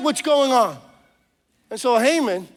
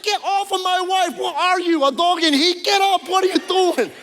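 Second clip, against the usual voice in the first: outraged king; 6 semitones higher than usual. Acted. King finds Haman on his wife.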